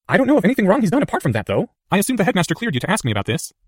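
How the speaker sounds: put-on a clever boy weighing his words; earnest schoolboy reasoning; young Harry voice.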